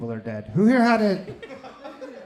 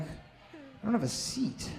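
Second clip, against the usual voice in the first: slow, quiet disbelief; stunned; 8 semitones higher, 8 dB quieter, much slower than usual. Retold or imitated. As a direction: let it sink in slowly. Shocked at boarding pass.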